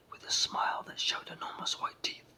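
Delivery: plain reading voice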